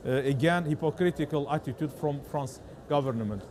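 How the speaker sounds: English with a strong Azerbaijani accent. Real, not acted. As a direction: speak English the way a Baku reporter does. Interviewer asks about France.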